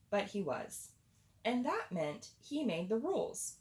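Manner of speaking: ordinary delivery, nothing marked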